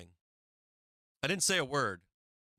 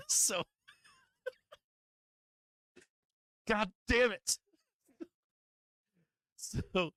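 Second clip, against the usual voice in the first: cracking up, voice pushed way high; real laughing fit; 9 semitones higher, slower than usual. Real, not acted. Storyteller curses himself for blurting buddy's name, laughing helplessly.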